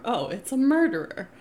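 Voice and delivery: loud, high, slowed alarm; alarmed warning. Retold or imitated. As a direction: sound the alarm, stay inside.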